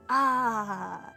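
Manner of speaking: normal talking voice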